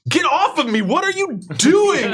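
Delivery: very high, loud startled shriek; shrieking townsperson. Acted. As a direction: scream like a grabbed stranger. NPC grabbed, shrieks.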